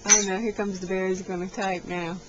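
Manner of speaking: ordinary talk, nothing marked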